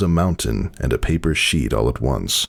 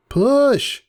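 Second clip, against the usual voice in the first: high sharp command to push; urging pony; 3 dB louder than usual. Acted. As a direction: call out push, pitched up. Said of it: "she heard some pony say".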